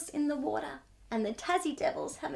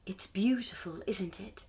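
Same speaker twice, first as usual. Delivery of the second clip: soft, hushed wonder; whispering owl; 7 semitones lower, 3 dB quieter than usual. Acted. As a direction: breathe it out gently. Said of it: "smiles the owl".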